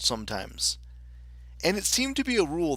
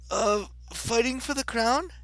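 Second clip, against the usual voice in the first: high-pitched little Alice voice; Alice, polite; 10 semitones higher than usual. Acted. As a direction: answer in a high girlish Alice voice.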